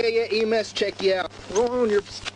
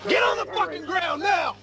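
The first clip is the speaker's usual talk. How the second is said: shouted commands; 11 semitones higher than usual. Real, not acted. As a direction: yell it at the suspect. Officer orders suspect down.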